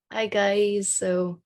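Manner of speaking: ordinary talk, nothing marked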